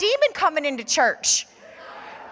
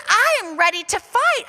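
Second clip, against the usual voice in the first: retold anger; 5 semitones higher than usual. Woman tells the pastor she's mad at the devil, fired-up. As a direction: spit it out like she's just discovered the betrayal.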